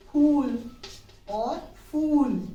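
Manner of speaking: फूल loud with ph, then फूल slow with f; ph and f contrast. Shown as a demonstration. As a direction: contrast फूल: aspirated ph, then teeth-on-lip f. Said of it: "ninety percent लोग इसको फूल बोलते हैं".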